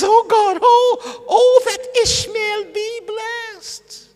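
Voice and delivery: high, slow, crying wail to God; a father's desperate sobbing plea; tearful pleading. Acted. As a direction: beg through tears, stretching every word.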